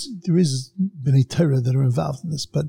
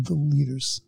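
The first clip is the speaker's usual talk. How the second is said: usual speaking voice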